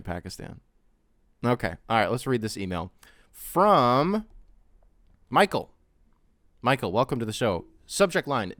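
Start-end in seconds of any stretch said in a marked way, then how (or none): 3.3-5.7 big radio announcer call. Acted. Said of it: "announcing the email sender".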